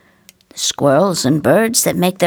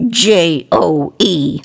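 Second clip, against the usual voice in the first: slow spelled out; 2 semitones lower, slower than usual. Acted. Marie spelling out the name.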